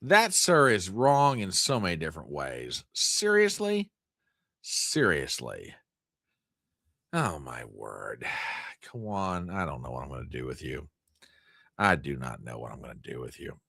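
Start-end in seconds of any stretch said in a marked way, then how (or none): 2.9-3.9 high disbelieving seriously; can't believe it; incredulous. Real, not acted. Reacting to a rude viewer, high disbelief. 4.6-5.7 low, slow, deflated seriously; resigned. Real, not acted. Answering his own shocked question, low and resigned. 7.1-13.6 exasperated. Real, not acted. Exasperated at a viewer.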